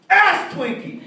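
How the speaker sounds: high, loud shout of a made-up swear; shouted insult. Retold or imitated. Dad yells made-up swear at Subaru driver. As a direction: yell it at the slow driver.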